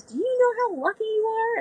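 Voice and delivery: high mock incredulous mentor; John's question. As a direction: pitch it up, teasing disbelief. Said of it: "he goes".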